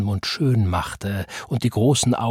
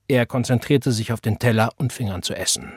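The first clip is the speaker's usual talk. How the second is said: plain reading voice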